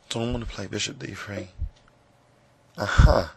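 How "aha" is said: ordinary talk, nothing marked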